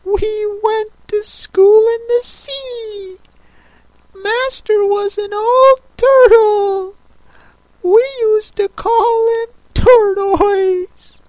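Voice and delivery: very high, slow tearful turtle voice; sobbing a little. A narrator's reading. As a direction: draw it out in a high, plaintive wail. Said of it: "still sobbing a little".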